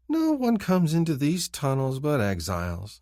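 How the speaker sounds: slow, high sing-song taunt. A narrator's reading. Kinfar teases the narrator in sing-song. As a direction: lilt it like a teasing rhyme. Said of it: "he says in a sing-song voice".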